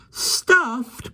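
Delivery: high, horrified cry. A narrator's reading. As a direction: cry it out in horror. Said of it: "cried Jane in a horrified voice".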